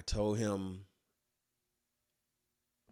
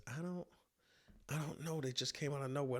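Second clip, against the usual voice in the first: high, soft scared passenger; timid passenger; 6 semitones higher, 8 dB quieter than usual. Retold or imitated. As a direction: mumble it, shaken and meek. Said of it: "And he says".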